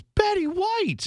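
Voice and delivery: high, slow and drawn out; wife's slow high reveal. Retold or imitated. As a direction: let the name hang in a high, slow voice. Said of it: "She goes".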